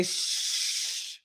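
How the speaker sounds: whispered hush; shushing. Retold or imitated. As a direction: hush someone to silence. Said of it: "they say".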